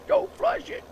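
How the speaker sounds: very high mock wail; panicked drunk punchline. Streamer replaying the punchline in a very high squeal.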